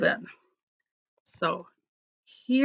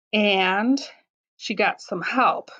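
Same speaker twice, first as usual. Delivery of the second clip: ordinary delivery, nothing marked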